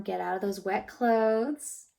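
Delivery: ordinary delivery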